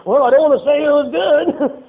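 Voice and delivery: slow, loud, reluctant praise; grudging loud compliment. Retold or imitated. Churchgoer grudgingly praising the preacher, loud and slow. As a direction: drag it out like you hate to admit it.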